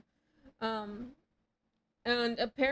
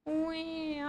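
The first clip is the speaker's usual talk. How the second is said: usual speaking voice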